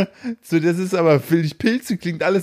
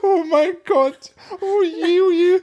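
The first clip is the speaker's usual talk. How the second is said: laughing; 13 semitones higher than usual. Real, not acted. Helpless laughter.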